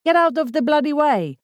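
high, loud road-rage shout; loud, impatient bellow; angry yell. A narrator's reading. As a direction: yell at the driver blocking you. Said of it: "yelled the bearded man".

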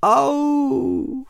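a high, far pained cry; moan of pain. A narrator's reading. Larch moans high in pain as the ant bites its trunk. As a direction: cry out as the jaws bite in. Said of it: "zasténal modřín".

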